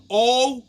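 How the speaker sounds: "Oh" as one loud, isolated vowel; sounding it out. Retold or imitated. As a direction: model "Oh" for a learner. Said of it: "say it with me".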